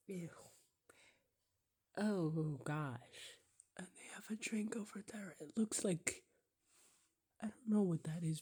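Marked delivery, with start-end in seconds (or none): 3.8-5.3 quick hushed whisper over the video. Real, not acted. A viewer whispering fast about the drinks on screen. Whispering while watching clips. 7.4-8.4 whisper. Real, not acted. Whispering while watching clips.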